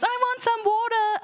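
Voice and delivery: put-on demanding child. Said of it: "So when somebody goes".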